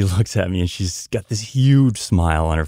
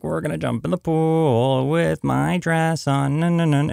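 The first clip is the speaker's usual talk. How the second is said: put-on excited child; 8 semitones higher than usual. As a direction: chant it like a thrilled kid. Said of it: "She's like".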